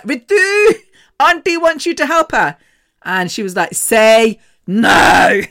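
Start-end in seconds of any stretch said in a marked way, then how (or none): 0.0-2.6 high, loud singling-out of the name; mum calling. Retold or imitated. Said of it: "my mum was like". 3.7-5.4 loud, drawn-out mock command; pissed off. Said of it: "so pissed off".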